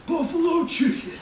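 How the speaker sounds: high, loud, drawn-out gushing stranger; enthusiastic stranger. Retold or imitated. Bodega stranger praising the narrator's order, high and loud. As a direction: gush over the sandwich like an overeager local.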